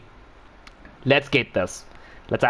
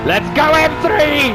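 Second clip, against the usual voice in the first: high, loud rallying shout; excited cheer; 11 semitones higher, 5 dB louder than usual. Real, not acted. Driver cheering on his car at the start, loud cheer. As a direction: fire yourself up to go.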